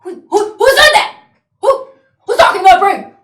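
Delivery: high, loud, stammering alarm; startled panic. Acted. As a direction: cry out as if spooked by a voice from nowhere. Bully startled by telepathic voice.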